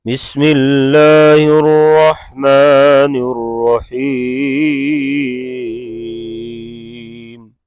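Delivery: tajweed-style recitation; Quran recitation. Acted. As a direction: chant it with long held vowels. Teacher recites the surah.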